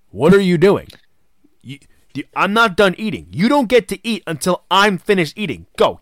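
yelling high and loud at the underling; barking him out of the room, hammed up; mock angry yell. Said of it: "He's like".

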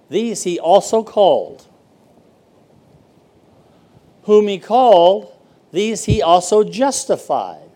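slow, loud, emphatic verse reading; scripture reading. Acted. Pastor reads Romans to the church, emphatic scripture reading. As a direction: proclaim the verse slowly and firmly.

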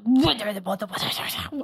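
exasperated dad, last words whispered; dad impression. Imitating her dad's exasperation, trailing into a whisper. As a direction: sputter like a flustered dad, fading to a breath. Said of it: "if I ever do my dad".

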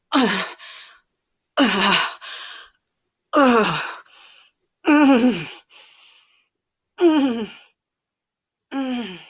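shuddering moans, open then closed-mouthed; moaning. Acted. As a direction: moan in separate bursts, settling into hums. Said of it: "I moan with every shake".

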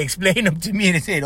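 usual speaking voice

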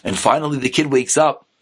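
ordinary talk

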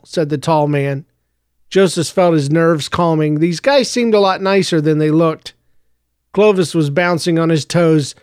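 ordinary delivery

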